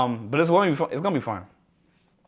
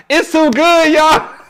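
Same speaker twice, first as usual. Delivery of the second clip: laughing tease; 12 semitones higher, 6 dB louder, slower than usual. Real, not acted. Teasing, can't tell them.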